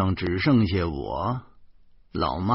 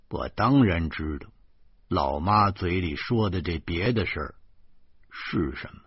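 ordinary delivery, nothing marked

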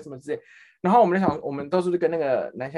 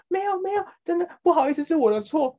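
much higher, timid apologizing voice; meek apology. Retold or imitated. Scared trainee apologizes to the angry supervisor, high and timid. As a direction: play the scared trainee saying sorry.

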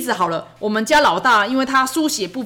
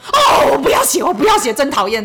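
much higher, louder whiny shout; child shout refusal; 4 semitones higher, 5 dB louder than usual. Retold or imitated. Son shouting at mom that he won't write. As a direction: yell like a fed-up kid throwing down his pencil.

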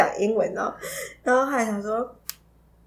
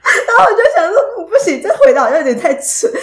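higher, much louder, laughing; laughing at herself; 9 semitones higher, 10 dB louder than usual. Real, not acted. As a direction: burst out laughing at her own answer. 笑自己回答太蠢. (一边大笑一边自嘲)

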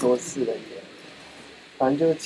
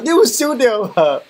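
calling out; 9 semitones higher, 4 dB louder, slower than usual. Retold or imitated. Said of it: "然后我就开始大喊".

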